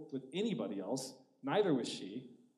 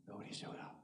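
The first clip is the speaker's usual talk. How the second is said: hushed telling; 7 semitones lower, 16 dB quieter than usual. Acted. Telling of failure.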